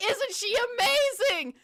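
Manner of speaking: high, loud, over-the-top adoration; mock gushing shout. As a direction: shriek fake praise as the climax of a parody. Climax of parody gushing over heroine.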